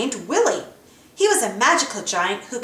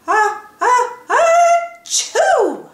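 rising, shrieking high sneeze; big sneeze; 14 semitones higher, 6 dB louder, much slower than usual. A narrator's reading. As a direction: build up and explode into a sneeze. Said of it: "made him sneeze".

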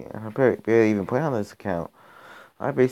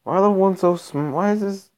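higher, louder, baffled at the opponent; can't believe how good they are; exasperated disbelief; 6 semitones higher, 5 dB louder than usual. Real, not acted. Baffled by strong level-one opponent.

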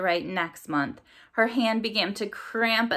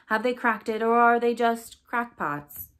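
higher, louder nasal radio newsreader; nasal newscaster; 5 semitones higher, 4 dB louder than usual. A narrator's reading. Newscaster mocking fringe chronologists on the radio, nasal and higher. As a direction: pitch it up like a teasing news headline. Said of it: "The nasal newscaster continued".

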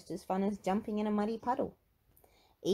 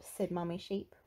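ordinary delivery, nothing marked

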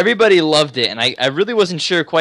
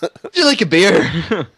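mock Irish; 4 semitones higher, 3 dB louder, slower than usual. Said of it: "imitating Irish".

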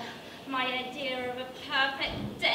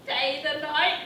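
suggestive; 6 dB louder than usual. Acted. Jane's suggestive offer then laugh.

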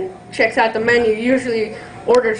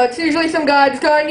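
normal talking voice

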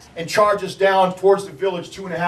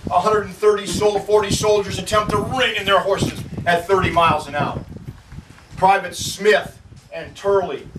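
ordinary delivery

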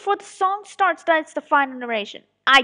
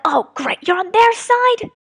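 acted dismay; 5 semitones higher, 5 dB louder than usual. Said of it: "that thing where it's like".

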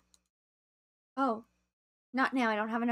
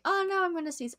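mock distress; 8 semitones higher than usual. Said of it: "and then I'm like".

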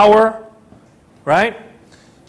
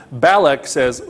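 ordinary talk

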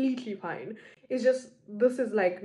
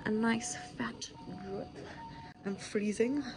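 whispering; 9 dB quieter than usual. Real, not acted. Quiet aside in hospital bed.

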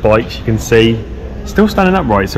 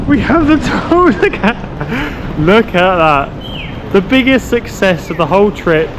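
jubilant; 11 semitones higher than usual. Real, not acted. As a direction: celebrate the found tools. Tools found, jubilant high voice.

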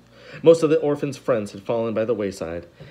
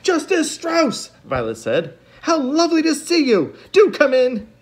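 much higher, louder, slow greeting; delighted welcome; 6 dB louder, slower than usual. A narrator's reading. Violet welcoming Justice Strauss, delighted high greeting. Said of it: "They were delighted".

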